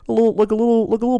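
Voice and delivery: high-pitched cutesy babbling imitation; cute little noises. Storyteller imitating the monster behind the curtain, cute high noises. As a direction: coo it high, like baby talk. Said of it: "like, cute little s".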